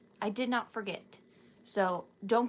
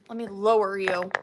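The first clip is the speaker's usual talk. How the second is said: ordinary delivery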